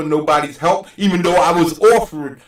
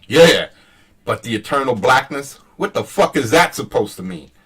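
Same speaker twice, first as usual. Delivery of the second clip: ordinary delivery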